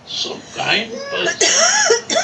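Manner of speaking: laughing fondly. Real, not acted. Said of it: "laughs while praising".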